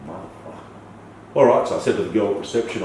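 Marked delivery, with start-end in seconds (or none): 0.1-1.3 whispered curse under the breath. Real, not acted. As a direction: breathe the curse out slowly, barely voiced. Realising he was robbed.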